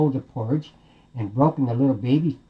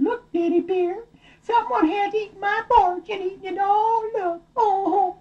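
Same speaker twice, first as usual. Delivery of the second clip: very high, tearful mama bear voice; hammed-up wailing complaint; upset Mama Bear. Mama Bear upset over eaten porridge.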